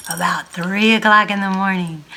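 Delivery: ordinary delivery, nothing marked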